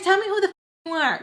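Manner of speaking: high, mocking challenge; arguing back. Daughter arguing back at her mama, mock defiant. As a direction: act out sassing mom in a high voice. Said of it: "We sounded so stupid".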